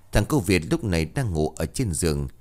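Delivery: usual speaking voice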